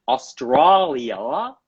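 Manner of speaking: Australia said slowly in a put-on Australian accent. Shown as a demonstration. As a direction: drawl out Australia like an Aussie. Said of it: "That's how they say Australia down there".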